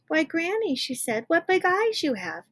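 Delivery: put-on high little-girl voice; wondering little girl. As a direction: ask like a wide-eyed child. Girl's famous questions to wolf.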